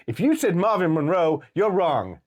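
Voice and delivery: a hint of a smug quiz presenter; quoting the host. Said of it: "then it was like".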